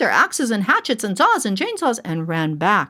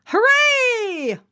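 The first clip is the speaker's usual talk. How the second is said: high cheering shout; crowd cheering. A narrator's reading. Townspeople cheering as the axe bites, high shout. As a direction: cheer like the whole town. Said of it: "all the people shouted".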